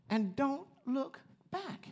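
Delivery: slow, stretched, rising warning; drawn-out warning. Acted. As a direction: linger on every word as a caution. Repeating the angel's command.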